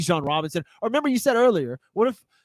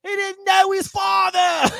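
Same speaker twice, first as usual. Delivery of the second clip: higher, laughing mock shout; 11 semitones higher, 4 dB louder than usual. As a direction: blurt it out cracking up. Joke shouted through laughter.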